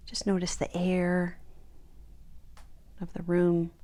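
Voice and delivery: soft, unhurried meditation guide with long pauses; guided meditation. Acted. Teacher guiding listeners to notice the room around them, guided meditation. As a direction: ease them gently into stillness, leaving space between phrases. Begins leading the body scan.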